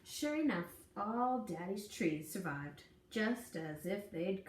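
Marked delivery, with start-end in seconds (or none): none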